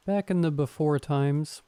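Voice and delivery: ordinary delivery, nothing marked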